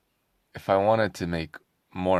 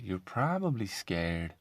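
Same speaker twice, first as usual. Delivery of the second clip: ordinary talk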